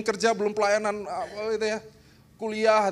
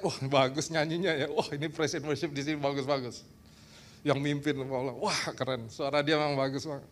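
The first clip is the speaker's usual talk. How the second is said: lower, softer voice of an impressed churchgoer; 8 semitones lower, 6 dB quieter than usual. Retold or imitated. Preacher imitating a churchgoer praising the worship, in a low soft voice. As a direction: mimic a churchgoer gushing about the singing, lower and softer.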